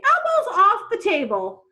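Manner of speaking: high, loud, slow prim instruction; a fussy lady correcting manners; prim guardian. Acted. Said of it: "she would say".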